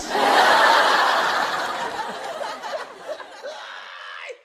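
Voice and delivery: high, loud shrieking laughter. Real, not acted. Laughing hard to her viewers at the fish drama. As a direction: scream with laughter.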